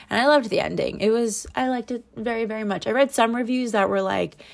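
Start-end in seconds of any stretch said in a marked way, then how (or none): none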